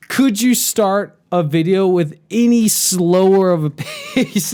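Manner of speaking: high, drawn-out incredulous demand; exasperated complaint. Acted. Viewer complaining about slow pipe videos, exasperated. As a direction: plead in mock disbelief, laughing near the end.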